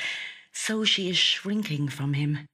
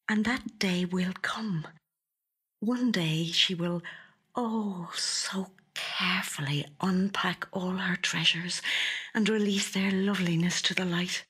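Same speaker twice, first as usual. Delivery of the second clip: longing whisper; 5 semitones higher than usual. A narrator's reading. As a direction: whisper it like a secret dream. Louise's private longing.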